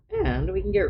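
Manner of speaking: the voice cracks up high on the first words. Real, not acted. Streamer explaining layers when her voice cracks. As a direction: let the voice break mid-phrase. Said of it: "ooh, my voice cracked".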